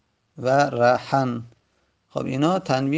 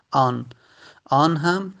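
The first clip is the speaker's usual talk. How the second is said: ordinary talk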